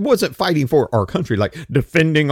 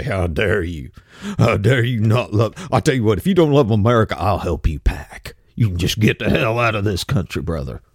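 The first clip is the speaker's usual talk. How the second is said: mocking boomer conservative; 7 semitones lower than usual. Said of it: "they'll gnash their teeth and say".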